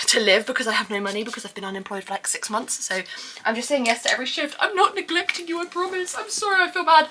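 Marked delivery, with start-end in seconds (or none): 4.6-7.1 high, pleading voice; pleading apology. Real, not acted. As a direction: plead your innocence in a high voice.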